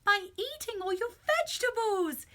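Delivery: high, loud cry of triumph; excited cry. A narrator's reading. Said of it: "he cried".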